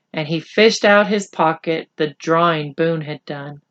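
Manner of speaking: usual speaking voice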